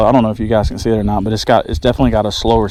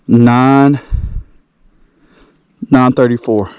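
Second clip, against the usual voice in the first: excited; 6 dB louder than usual. Real, not acted. Weighing record fish.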